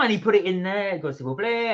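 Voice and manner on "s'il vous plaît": imitated French native accent. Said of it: "and like this".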